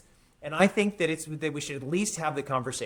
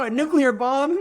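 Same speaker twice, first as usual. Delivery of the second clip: higher, louder mock-indignant outburst; mock incredulity; 9 semitones higher, 8 dB louder than usual. One-upping someone's complaint, loud mock incredulity. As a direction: act incredulous at someone's small worry.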